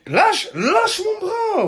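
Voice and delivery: high pained yelp from a squeezed arm; pained cry. Real, not acted. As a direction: cry out in real pain. Friend squeezing his arm.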